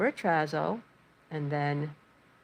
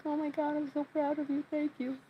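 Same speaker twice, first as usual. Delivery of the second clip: much higher, choked-up proud praise; teary joy; 11 semitones higher than usual. Retold or imitated. Owner praising her calm dog, teary and proud. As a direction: gush at her like you're about to cry. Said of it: "Why are you all teary-eyed".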